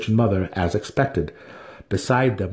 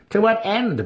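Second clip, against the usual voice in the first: high, snarling retort; older sister snarling at her younger brother; snarled; 13 semitones higher than usual. A narrator's reading. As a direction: snap it back at him. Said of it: "Brisa snarled at him".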